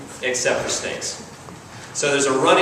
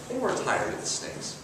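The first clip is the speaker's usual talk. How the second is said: whispered, much softer echo of the complaint; quiet echo; 6 semitones higher, 9 dB quieter than usual. Acted. Preacher repeating the Israelites' complaint, hushed whisper. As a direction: breathe the line back like an afterthought.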